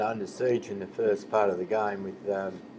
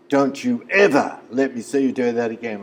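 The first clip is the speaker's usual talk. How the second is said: raised, stern warning, louder; a retold hard-man warning, voice raised; 3 semitones higher, 9 dB louder than usual. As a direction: lay down the law to a thug. Cowdery warns the bully, retold.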